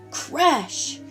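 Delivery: plain reading voice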